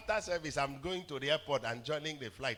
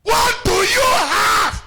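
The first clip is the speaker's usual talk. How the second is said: slow, very high, loud call; preaching shout; 19 dB louder, much slower than usual. Acted. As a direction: rouse the congregation.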